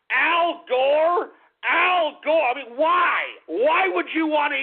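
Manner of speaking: high, loud disbelieving yell; can't believe it, shout the name twice; incredulous shout. Acted. Host reacts to Al Gore joining campaign, incredulous shout.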